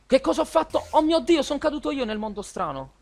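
high-pitched shocked outburst; shocked cry. Real, not acted. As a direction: yelp in sudden disbelief. Falls into a strange dimension. (grido scioccato)

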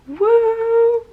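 high, loud happy whoop; excited cheer. Real, not acted. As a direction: cheer out with delight. Reacting to acceptance letter.

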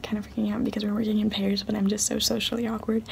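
fast nervous whisper; whispering while hiding. Real, not acted. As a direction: whisper it quickly so nobody hears.

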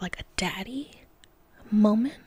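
hushed whisper so others won't hear. Real, not acted. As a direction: whisper it, people are outside. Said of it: "I have to whisper because there are people outside".

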